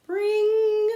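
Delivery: high, loud bell imitation; bell ring.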